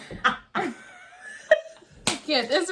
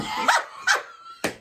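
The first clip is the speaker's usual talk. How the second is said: slow, breathy-sounding put-on starlet voice, much higher; Renee Zellweger impression. Doing her Renee Zellweger voice for her friend. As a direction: coo it slowly like Bridget Jones. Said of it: "my Renelle Zellweger voice".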